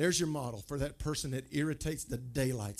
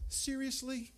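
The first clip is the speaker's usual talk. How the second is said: high, softer mock disbelief; pitched-up, pointed question; irritated wife's tone; 11 semitones higher, 5 dB quieter, slower than usual. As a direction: imitate a wife who is irritated. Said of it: "if you ever hear her with that tone".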